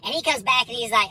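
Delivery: ordinary talk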